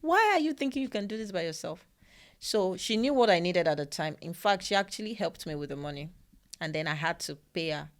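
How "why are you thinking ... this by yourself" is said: higher, a bit louder, firm rhetorical questions; friend scolding kindly. Retold or imitated. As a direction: retell the friend's gentle telling-off. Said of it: "She was like".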